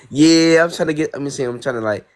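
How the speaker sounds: ordinary talk, nothing marked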